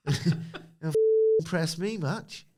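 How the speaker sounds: high, slow, mock Cockney singsong; a hint of a bad Cockney accent. Wrestling fan quoting [name] in mock Cockney. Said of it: "You sound like [name]".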